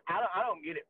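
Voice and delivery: normal talking voice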